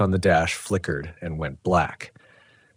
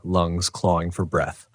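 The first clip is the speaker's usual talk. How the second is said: ordinary delivery, nothing marked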